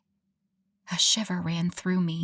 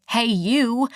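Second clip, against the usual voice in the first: yelling; 4 semitones higher, 4 dB louder than usual. Retold or imitated. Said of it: "It could have been more like".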